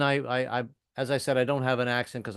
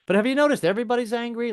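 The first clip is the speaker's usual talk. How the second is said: ordinary talk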